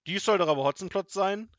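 loud, high, disbelieving question; incredulous cry. A narrator's reading. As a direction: call it out in indignant disbelief. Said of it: "rief er".

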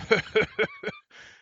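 high, loud staccato cackle; villainous laugh. A narrator's reading. Robber revealing himself to grandmother, loud gloating cackle. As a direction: cackle in triumph. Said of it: "Hotzenplotz radelte lachend weiter".

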